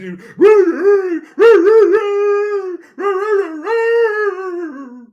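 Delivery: loud shrieking laughter; real howling laugh. Real, not acted. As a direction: burst out shrieking. Huge laugh at clip.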